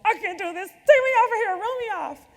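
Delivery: mock freak-out, pleading to be taken off the project; panicked outburst. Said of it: "I was freaking out".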